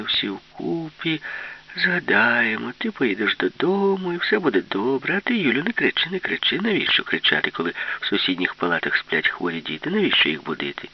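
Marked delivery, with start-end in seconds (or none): none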